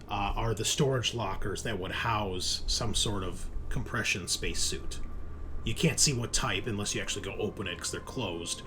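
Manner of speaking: ordinary delivery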